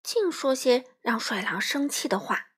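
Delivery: plain reading voice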